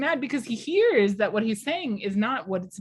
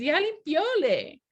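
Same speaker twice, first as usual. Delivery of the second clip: put-on higher, slow little boy voice; childish mock, higher and slow; imitating son; 9 semitones higher, slower than usual. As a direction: copy the child's wrong word. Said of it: "But he says".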